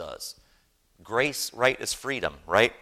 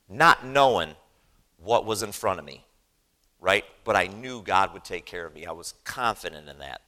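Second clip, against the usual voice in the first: usual speaking voice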